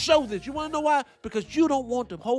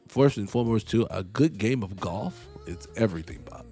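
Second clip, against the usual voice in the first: low, meek put-on voice for the boss; deep, overly agreeable job candidate voice; mock polite interviewee; 9 semitones lower than usual. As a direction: play the eager-to-please applicant, low and mild.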